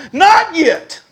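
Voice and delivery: high, loud shouted comeback; furious. Retold or imitated. Angry man answering the preacher who asks about trouble, furious. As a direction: fire it back at a shout. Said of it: "I was so furious".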